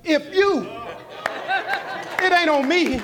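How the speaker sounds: loud, high-pitched sermon delivery; shouted pulpit rebuttal to the congregation; full-force preaching. Acted. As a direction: preach it hard, driving each phrase home.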